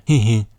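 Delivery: short mock Hulk chuckle; Hulk laughing. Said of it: "Hulk starts laughing".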